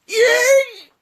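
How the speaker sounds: very high, loud shriek; dragon screech. Acted. Dragon Fang screeching as the arrow strikes. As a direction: let out a piercing squeal of pain. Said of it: "screeches Fang".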